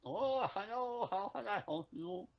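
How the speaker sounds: put-on high, slow-starting Indian-accented greeting. Co-host playing customer service, Indian accent. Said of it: "you put on an Indian accent".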